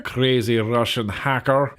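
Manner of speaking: low, slow mock Russian accent; terrible Russian accent. Vlogger mimicking a crazy Russian hacker, terrible Russian accent. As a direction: drop the pitch and do a bad Russian accent. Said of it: "that was a terrible Russian accent".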